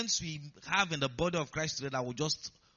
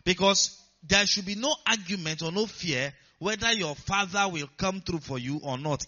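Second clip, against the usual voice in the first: usual speaking voice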